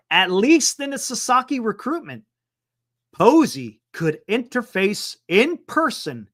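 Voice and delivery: ordinary delivery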